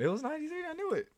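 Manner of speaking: high, triumphant gloat. Real, not acted. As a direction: crow over being right. Gloating over winning the argument.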